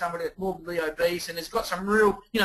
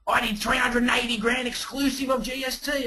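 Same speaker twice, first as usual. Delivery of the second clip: higher, shouted brag; proud boast; 5 semitones higher than usual. Retold or imitated. Colleague boasting his sales total, in a higher shout. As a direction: announce it like a triumph.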